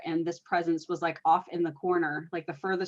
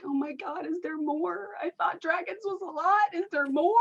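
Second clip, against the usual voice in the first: a high, startled gush of wonder; amazed, higher; 11 semitones higher than usual. Retold or imitated. Medium recalling meeting an elf, high amazed voice. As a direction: squeal it out in disbelief, high. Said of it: "I'm like".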